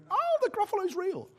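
put-on high, soft, awestruck little-kid voice; amazed small children. Young children discovering the Gruffalo is real, awed. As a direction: squeak it in hushed wonder. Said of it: "the children, of course, age three".

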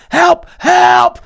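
high, loud panicked yell; screaming for help. Acted. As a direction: scream for help at the top of your lungs. Victim screaming for Secret Service.

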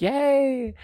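put-on high-pitched little "yay" for kids; high, sweetly fake delight; mock childish cheer. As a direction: cheer like a sugary kids' show.

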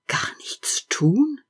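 slow, hushed whisper. A narrator's reading. The tree asking itself, sad whisper. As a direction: breathe the question sadly.

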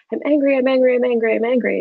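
mock nagging of an emotion demanding notice; insistent. Emotion signalling the brain that it is angry, insistent.

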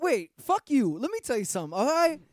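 loud, rowdy character rant; Chitlin Man. Acted. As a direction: bark it out like a brash loudmouth character. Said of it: "this new character you've developed".

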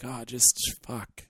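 quiet, slowed, worn-out grumble; imitated exasperation. Co-host imitating the tennis player's complaint, quietly exasperated. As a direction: imitate someone too fed up to be mad. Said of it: "it's more of an exasperated".

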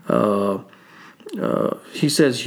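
usual speaking voice

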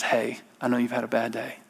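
quiet soothing counsel; soft whisper. Retold or imitated. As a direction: breathe the words like a calming inner voice. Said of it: "whispers in your inner soul".

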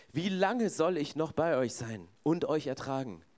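ordinary delivery

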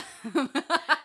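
real burst of laughter; laughing. Real, not acted. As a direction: laugh out loud at yourself. Laughing at own voice.

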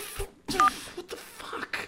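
breathless whispered curse. Acted. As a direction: say it under your breath. Frustrated caller aside.

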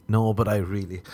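ordinary delivery

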